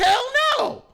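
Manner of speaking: high, loud shocked "Hell no"; shocked refusal. Retold or imitated. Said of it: "I said".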